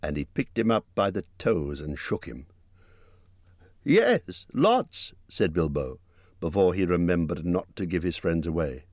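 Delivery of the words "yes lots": hobbit voice. A narrator's reading. Bilbo blurts out.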